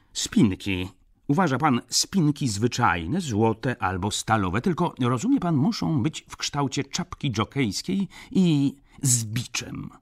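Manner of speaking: high, emphatic baron voice; excitable gentleman ordering at a counter; fussy baron customer. A narrator's reading.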